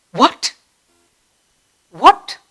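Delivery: rising, startled what; surprised what. Shown as a demonstration. Teacher showing surprise to the class. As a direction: ask it as if you can't believe it. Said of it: "What expressions?".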